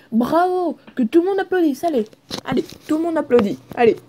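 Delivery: loud, high call for applause; rally the crowd to clap for you; triumphant cheer. Real, not acted. Gamer cheering himself to viewers, loud and high. Celebrates his success.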